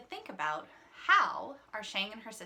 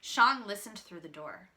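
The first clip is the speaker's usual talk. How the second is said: ordinary delivery, nothing marked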